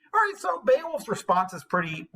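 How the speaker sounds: normal talking voice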